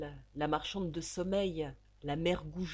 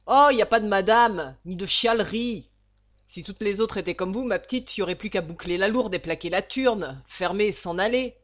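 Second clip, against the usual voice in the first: much louder, harsh scolding; scolding landlady; 11 dB louder than usual. Acted. The landlady loudly scolding the girl for whining. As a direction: cut her off and berate her.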